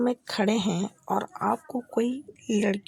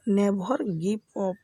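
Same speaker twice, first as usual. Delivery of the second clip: very slow, each word spelled out; slow teaching demo; much slower than usual. Shown as a demonstration. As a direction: say it slowly for learners to copy. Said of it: "English में क्या बोलेंगे". (धीमे-धीमे, साफ़ उच्चारण में)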